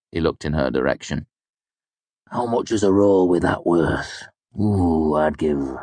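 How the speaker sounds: put-on an old drunk savoring gossip; leering drunken mercenary.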